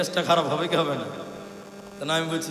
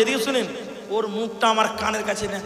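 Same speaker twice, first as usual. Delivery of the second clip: usual speaking voice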